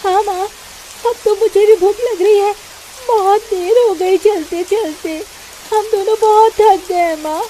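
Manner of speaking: put-on a weary girl pleading with mother; elder daughter telling mother they are exhausted; tired hungry girl.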